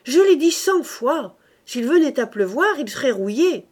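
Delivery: telling them off for the hundredth time; exasperated exclaiming. A narrator's reading. Father scolding the household over rusting tools, loud. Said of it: "s'écria-t-il".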